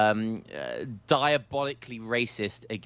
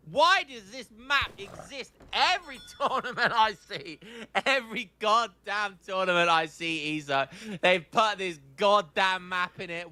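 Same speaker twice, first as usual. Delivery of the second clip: high-pitched, drawn-out theatrical despair; mock exasperated rant; 8 semitones higher than usual. Caster bemoaning a hated tournament map, mock exasperated rant. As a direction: rant in hammed-up exasperation, leaning on each complaint.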